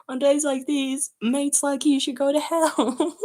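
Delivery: high, loud, slow mock British accent; hammed-up British menace, near laughing; Sans. Shown as a demonstration. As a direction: draw it out in a badly faked British voice. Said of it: "I can't do a British accent".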